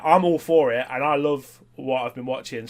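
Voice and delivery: ordinary delivery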